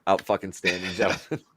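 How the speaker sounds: deadpan sarcastic compliment; mock praise. Host mockingly praising his friends, dryly. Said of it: "leave it alone and say".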